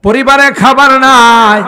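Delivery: high, loud preacher's lament; impassioned cry. Real, not acted. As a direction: cry it out to the congregation. Impassioned preaching about hungry family. (ওয়াজে উঁচু গলায় আবেগী আহাজারি)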